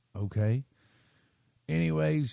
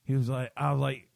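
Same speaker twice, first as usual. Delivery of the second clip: normal talking voice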